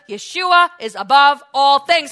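impassioned pulpit voice; full-force preaching. Real, not acted. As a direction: drive each phrase home to the congregation.

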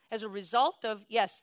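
ordinary talk, nothing marked

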